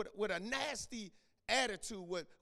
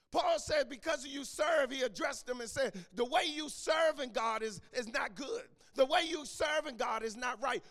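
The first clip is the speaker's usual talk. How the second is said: higher, louder preaching of Paul's rebuke; 4 semitones higher, 4 dB louder than usual. Acted. Preacher thundering Paul's rebuke at the church, higher and louder. Preacher voicing Paul, loud.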